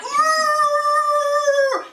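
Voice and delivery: long, very high, loud roar; bear roar. Acted. Bear after losing a hair, roaring. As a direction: roar at full force. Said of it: "he roars like".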